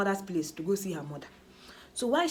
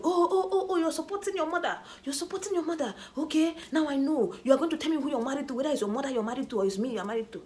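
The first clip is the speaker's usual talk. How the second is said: mocking husband; 8 semitones higher than usual. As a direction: ham up his sarcastic complaint, fast. Quoting angry husband mockingly.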